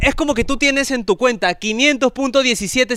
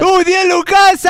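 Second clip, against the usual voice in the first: higher, louder excited shout; excited whoop; 7 semitones higher, 4 dB louder than usual. Real, not acted. Host shouts with excitement at a bigger donation. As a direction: cheer the donation.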